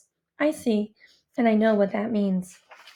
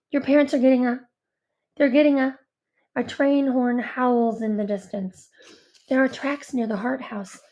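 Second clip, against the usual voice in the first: ordinary delivery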